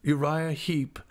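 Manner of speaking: dull, forced monotone. A narrator's reading. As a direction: say it dully, as if forced. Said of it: "in the same dull voice".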